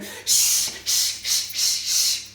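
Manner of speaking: sharp repeated "shh"; string of loud hushes; insistent shushing. Acted. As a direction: crowd hushing someone noisy. Acts people hushing Bart.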